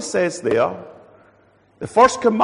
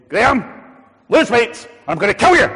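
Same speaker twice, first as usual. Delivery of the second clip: loud, higher mock threat; threatening doctor; 7 semitones higher, 9 dB louder than usual. Doctor threatening Graham instead of caring, loud mock threat. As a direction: bark it like a bully. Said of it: "my doctor doesn't say to me".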